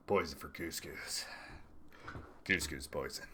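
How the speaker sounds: low, soft cartoon henchman impression; Kronk voice. Streamer trying out Kronk for candidate quotes, deep Kronk impression. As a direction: drop it way down into a Kronk voice. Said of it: "in the Kronk voice".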